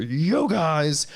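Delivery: high far-off "Yo, guys" shoved at the lens; hammed-up YouTuber hello; hyped greeting up close. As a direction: greet the camera from an inch away. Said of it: "one inch from the front of the camera".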